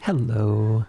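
polished telephone greeting; phone voice. Real, not acted. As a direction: answer like picking up a call. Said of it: "This is my phone voice".

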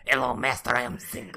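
hammed-up sci-fi henchman; eager sidekick voice. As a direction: play a fawning movie sidekick. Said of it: "sidekick in a sci-fi movie".

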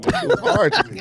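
high laughing voice. Real, not acted. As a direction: laugh through it.

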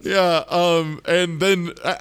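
normal talking voice

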